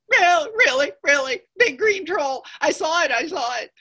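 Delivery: high, breathless-sounding alarm; panicked goat. Acted. Medium goat reporting to his brothers, high and panicked. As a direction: insist loudly in a high voice.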